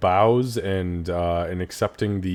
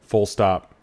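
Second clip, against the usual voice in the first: ordinary delivery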